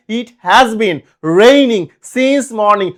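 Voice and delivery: slow read example. Shown as a demonstration. Teacher reading an exam sentence aloud, slowly. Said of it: "একটা প্রশ্ন পরীক্ষায় প্রায় আসতে দেখা যায়".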